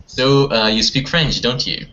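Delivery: normal talking voice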